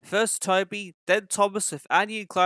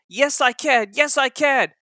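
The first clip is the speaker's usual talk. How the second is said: higher, louder, determined engine; brave puffing; 9 semitones higher, 7 dB louder than usual. A narrator's reading. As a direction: puff it out bravely, full of courage. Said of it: "he puffed bravely".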